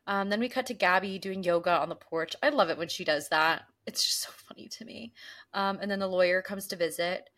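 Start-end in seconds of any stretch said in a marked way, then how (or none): 3.9-5.1 whispering. Real, not acted. Whispered aside about Gabby.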